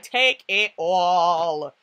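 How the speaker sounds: very slow, stretched-out words; drawn-out hype. Retold or imitated. Fan handing it all to two favorites, drawn-out hype. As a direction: drag out each word for your favorites.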